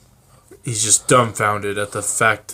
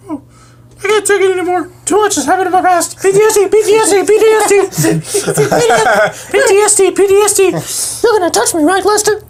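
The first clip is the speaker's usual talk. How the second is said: squeaky high bird voice, much higher and louder, fast and wailing; whiny suicidal bird; 9 dB louder, much faster than usual. Acted. Friends' improv story, bird PD wails he's done with life, high squeaky voice. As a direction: ham up a despairing pet bird.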